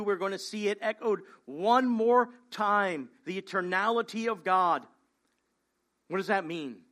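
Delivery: ordinary talk, nothing marked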